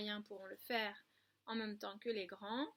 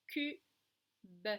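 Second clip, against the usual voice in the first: "Cube" split into cu... be; two syllables; 4 dB louder than usual. Shown as a demonstration. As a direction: clap out cu, pause, beuh. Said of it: "frappons les syllabes".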